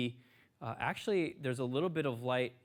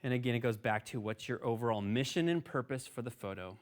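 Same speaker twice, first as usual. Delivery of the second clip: normal talking voice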